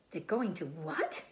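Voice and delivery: usual speaking voice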